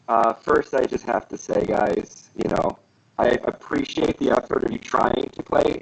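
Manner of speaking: robotic voice filter. Real, not acted. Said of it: "[name] turn into a robot?".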